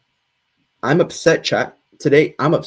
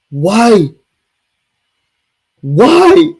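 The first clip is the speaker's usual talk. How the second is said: exasperated yell; 5 semitones higher, 6 dB louder than usual. Real, not acted. Ranting at chat, repeated whys.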